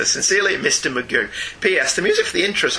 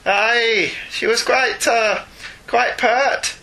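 higher-pitched, hammed-up Scottish brogue; funny Scots accent; 5 semitones higher, slower than usual. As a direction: put on a comic Scots voice. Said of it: "in a funny Scots accent".

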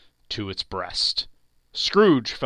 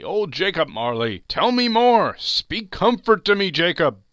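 imploring; 8 semitones higher, 8 dB louder than usual. Acted. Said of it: "he said imploringly".

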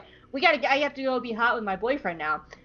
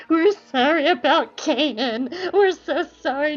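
higher, wobbling fake-crying voice; hammed-up weepy remorse; mock tearful apology; 4 semitones higher, 4 dB louder, slower than usual. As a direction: whimper it slowly, pretending to cry. Two characters apologise tearfully.

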